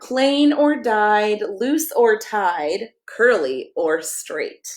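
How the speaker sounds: slow rhyming lines, ending on a hushed whisper; slow read-aloud ending. Acted. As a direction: savour each word like the last page. Finishing the book slowly.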